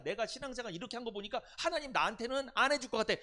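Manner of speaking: doubting believer. Acted.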